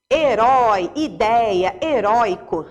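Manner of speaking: ÓI and ÉI in "herói, ideia, heróico" voiced strong and open; open diphthong. Shown as a demonstration. Teacher showing students the strong open sound in "herói, ideia, heróico", open diphthong. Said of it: "o som é forte, o som é aberto".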